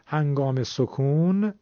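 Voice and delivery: slow, سکون held long with a coo at the end; drawn-out zad. Shown as a demonstration. Quran teacher showing the stretched zad to students. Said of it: "یک صدایی داره، با اون صدای کش اومدنش".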